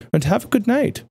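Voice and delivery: high, sing-song sarcastic farewell; mock-sweet goodnight. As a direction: sign off with fake sweetness. Villain's mocking farewell.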